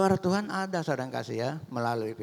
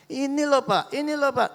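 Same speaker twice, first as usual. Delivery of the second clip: seruan istri bernada tinggi dan keras; istri heboh; 11 semitones higher, 4 dB louder than usual. Acted. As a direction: memanggil suami dengan heboh, nada melonjak. Ibu memanggil suami dengan girang. (istri berseru heboh)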